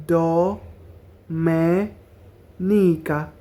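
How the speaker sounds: very slow broken syllables; ডোমেনিকা split into slow syllables. Shown as a demonstration. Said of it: "এভাবে syllable ভাঙা ভাঙা".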